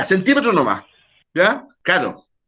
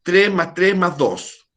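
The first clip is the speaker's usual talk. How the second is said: slow; much slower than usual. Shown as a demonstration. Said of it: "Decir, ¿verdad?".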